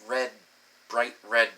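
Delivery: ordinary talk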